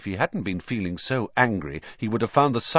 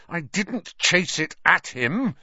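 shaking with anger; 8 semitones higher, slower than usual. A narrator's reading. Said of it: "his voice shaking with anger".

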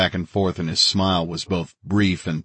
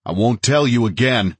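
raised, higher-pitched warning; edge in the voice; 4 semitones higher, 4 dB louder than usual. A narrator's reading. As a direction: warn firmly, as a last order. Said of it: "keeping the edge in my voice".

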